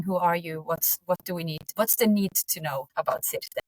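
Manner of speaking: ordinary talk, nothing marked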